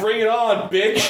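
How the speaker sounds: mock bellow. Retelling a hero's last stand to friends, mock bellow. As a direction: bellow a dare, half laughing. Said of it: "And he bellowed at it".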